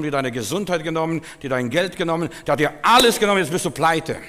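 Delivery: lauter, höher und steigernd gepredigt; Predigt mit voller Kraft. Acted. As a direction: auf den Höhepunkt hin hinaufpredigen. Preaching at full force. (lauter, anschwellender Predigerton)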